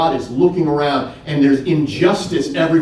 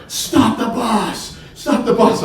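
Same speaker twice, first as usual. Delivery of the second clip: high, slow urgent yell; a boy yelling at the bus driver to stop; retold yell; 7 semitones higher, slower than usual. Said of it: "he yells at the bus driver".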